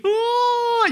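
high, held "oh"; comic high wail of dismay; mock wail. Mocking each rapture position.

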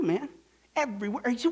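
ordinary talk

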